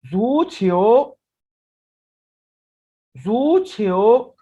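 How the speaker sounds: जू छियो slow and loud with a rising end; Chinese word demo. Shown as a demonstration. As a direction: model जू छियो loudly, letting it rise. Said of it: "Read after me, please". (जू छियो धीरे, ज़ोर से, उठते सुर में)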